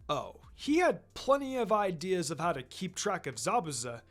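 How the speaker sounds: usual speaking voice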